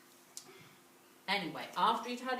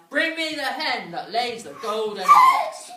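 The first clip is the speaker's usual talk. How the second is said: booming giant bellowing an order; giant's roar; 2 semitones higher, 15 dB louder than usual. A narrator's reading. Giant orders his wife.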